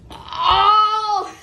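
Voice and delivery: loud high cry out; pained cry. Real, not acted. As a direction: cry out in agony. Sour candy pain.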